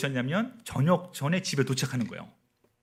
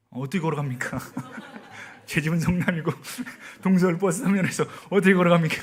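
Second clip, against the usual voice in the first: laughing through the question, growing louder; incredulous laughing; 4 semitones higher, 5 dB louder than usual. Real, not acted. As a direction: protest loudly between real laughs. Laughs at own absurd predicament.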